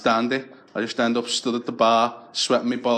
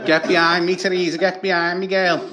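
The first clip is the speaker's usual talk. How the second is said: put-on protective girl; 6 semitones higher, 4 dB louder than usual. Girls protecting friend.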